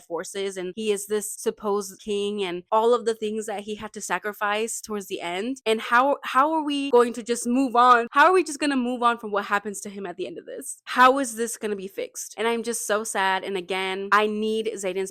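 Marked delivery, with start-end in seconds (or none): 5.7-9.3 louder, pleading in disbelief; distraught outcry. Real, not acted. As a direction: cry out as if you can't let it go. Distraught over the ending.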